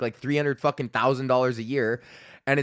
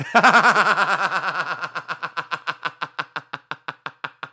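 long real laugh; 4 semitones higher, 4 dB louder than usual. Real, not acted. Laughs at wife's interruption.

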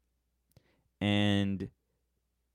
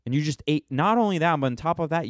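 usual speaking voice

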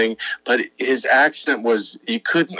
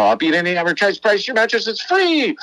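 mock ad slogan; 10 semitones higher than usual. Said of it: "it became the, you know".

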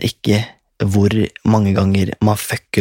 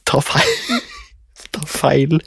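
laughing; 6 semitones higher than usual. Retold or imitated. Mocking the mistaken grab.

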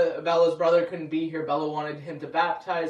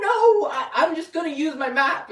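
put-on much higher, louder old woman's voice; old lady driver; 10 semitones higher, 5 dB louder than usual. Old taxi driver refusing the GPS, high and stubborn.

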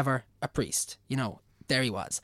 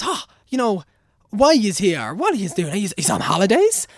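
higher, louder mock old local; quizzing the couple about their trip; hammed-up rural old man; nosy old man; 7 semitones higher, 7 dB louder than usual. Old man voiced asking questions.

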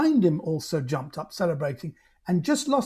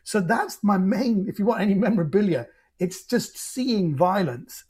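ordinary talk, nothing marked

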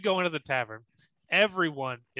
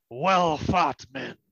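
groggy captain; 6 dB louder, much slower than usual. Acted. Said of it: "and he goes".

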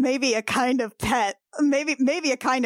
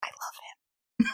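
tender whisper. Acted. Whispering love for the cat.

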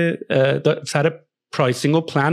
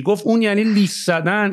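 ordinary delivery

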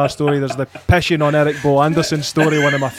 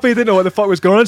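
ordinary delivery

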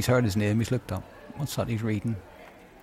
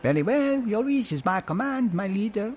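servile assistant; 7 semitones higher, 3 dB quieter than usual. Acted. Baptiste answers his leader.

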